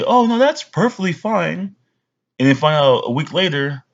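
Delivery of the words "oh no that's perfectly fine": higher, slow falsely sweet; mock a polite lie; fake reassurance. Friend hiding true opinion, mock sweet and slow. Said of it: "than to have them say".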